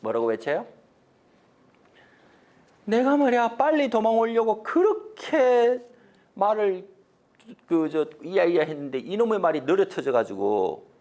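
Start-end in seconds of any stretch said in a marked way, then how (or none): 2.8-10.9 hammed-up public grumbling; general crying out. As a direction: call out excuses for all to hear. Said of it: "그때 맹지반이 외칩니다".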